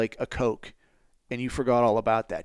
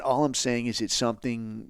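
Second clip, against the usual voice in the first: normal talking voice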